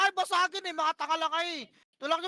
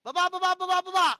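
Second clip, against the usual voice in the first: urgent callout; 4 semitones higher, 5 dB louder than usual. Real, not acted. Calling out commands mid-fight.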